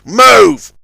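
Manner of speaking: loud high shout; barked order. A narrator's reading. Johnny Cuba shouting at Zebedee to move. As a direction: bark the order.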